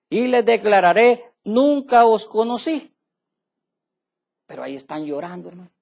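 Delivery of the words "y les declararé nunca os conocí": louder, slow, every word weighed; solemn judgment. Acted. Preacher declares the Lord's verdict to the congregation, slow and loud. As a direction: pronounce it like a final sentence.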